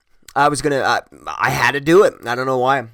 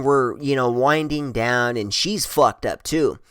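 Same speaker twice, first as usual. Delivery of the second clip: ordinary talk, nothing marked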